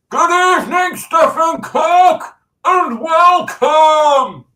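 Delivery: very high, loud, drawn-out greeting; hammed-up welcome shout. Streamer welcomes Stephen Cook to the stream, hammed-up shout. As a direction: stretch each name out in a mock grand holler.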